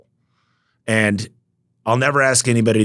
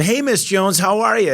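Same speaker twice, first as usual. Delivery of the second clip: much higher, upbeat customer-service hello; imitating his own chirpy higher phone voice; bright phone greeting; 12 semitones higher than usual. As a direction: greet her like a friendly salesman on the phone. Retelling his sales call to customer.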